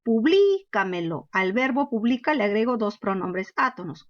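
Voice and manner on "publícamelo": publícamelo, slow, weight on LÍ; teacher shows a sobresdrújula slowly; stress on LÍ. Shown as a demonstration. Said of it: "quiero que sea sobre esdrújula".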